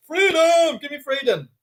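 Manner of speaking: high cry of freedom fading off; William Wallace cry. Pretend. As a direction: call for freedom like a rebel leader, then let it drop. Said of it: "William Wallace".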